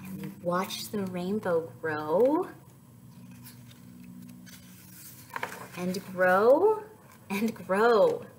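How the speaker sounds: slow, gentle storybook reading; picture book read aloud; reading to children. Acted. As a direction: read it like story time for toddlers.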